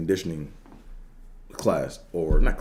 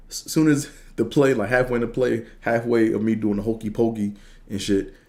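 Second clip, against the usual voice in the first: excited; 4 semitones higher, 4 dB louder than usual. Real, not acted. Excited storytelling about the play.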